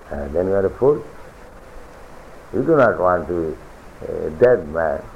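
ordinary talk, nothing marked

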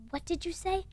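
mildly indignant, pitched high; Malfoy spitting. A narrator's reading. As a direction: demand it with a touch of outrage. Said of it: "spat Mister Malfoy".

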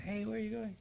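imitating an old woman calling out. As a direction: squeak it out like an elderly woman. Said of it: "seemingly eighty-year-old women".

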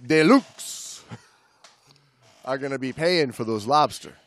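steady read-aloud narration; book reading. Acted. Podcast host reading a diving memoir aloud, narrating. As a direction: read the passage to the listener.

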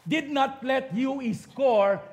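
ordinary talk, nothing marked

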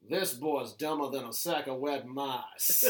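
low, soft blustering Southern rooster voice; Foghorn Leghorn. Retold or imitated. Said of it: "foghorn leghorn".

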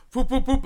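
high, loud whoop whoop imitation; mock whoop sounds. Retelling the witch's spell to a friend, mock whoops.

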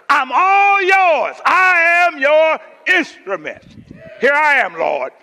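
high, slow preacher's shout; Doctor Fred Sampson. Retold or imitated. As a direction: cry out in full-throated surrender, imitating a pulpit prayer. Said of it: "That's how he talk".

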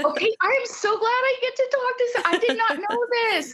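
high, fast giddy delight; sheepish relief. Real, not acted. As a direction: gush with embarrassed joy. Hearing she was adorable.

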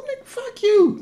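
laughing retort. Real, not acted. Jokey rant punchline.

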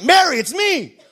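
high, hammed-up impatient scolding; mock exasperation. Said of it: "Jesus isn't like".

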